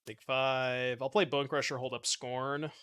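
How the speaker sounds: usual speaking voice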